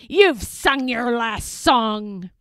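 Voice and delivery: loud, angry pirate captain; pirate woman yelling. Acted. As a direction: snap at him like a pirate boss. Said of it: "she yelled".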